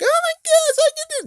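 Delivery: very high squeaky mock girl voice; ditzy little sister, way up high. Mocking the dumb sister in a squeaky voice. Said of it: "the sister's a dumbass because she's like".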